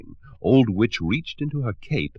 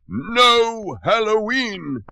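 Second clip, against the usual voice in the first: high, loud, slow bellow; thundering shout; 5 dB louder, much slower than usual. Acted. Butterbean banning Halloween, slow loud bellow. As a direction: stretch it out like a ruling tyrant. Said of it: "Butterbean thundered".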